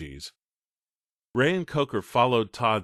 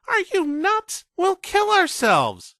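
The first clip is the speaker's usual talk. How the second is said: much higher, louder, slow panicked cry; horrified shout; 7 dB louder, slower than usual. Acted. Young Todd protesting the dangerous ramp, horrified shout. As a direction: shout it in horror. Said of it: "He shouted".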